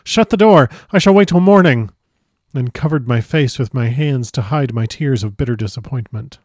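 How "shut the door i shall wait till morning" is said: high, loud desperate shout; desperate cry. Acted. Jonathan crying out to the Count to shut the door, loud and desperate. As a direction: cry out in panic. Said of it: "I cried out".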